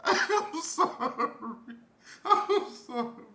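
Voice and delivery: high, slow apology through real laughter; helpless laughing. Real, not acted. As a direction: apologize while cracking up. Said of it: "I don't mean to laugh".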